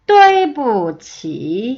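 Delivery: 对不起 very slow, sharp fall then a dip and rise; tone demonstration. Shown as a demonstration. Teacher modelling the whole phrase, 对不起 slow and toned. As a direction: hit 对, drop, then stretch 起 through its dip. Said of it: "한 번 더".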